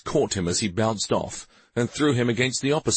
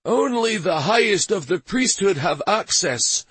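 higher, whiny priest; 11 semitones higher, 4 dB louder than usual. Acted. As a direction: plead like a cowering priest. Priest answering Stavin.

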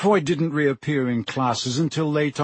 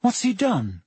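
high, nervous question; uneasy, higher-voiced query; 7 semitones higher than usual. A narrator's reading. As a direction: ask it warily, pitched up. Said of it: "said Harry nervously".

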